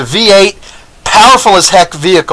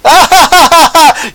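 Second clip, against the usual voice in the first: mocking laugh; 9 semitones higher, 3 dB louder than usual. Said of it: "They say".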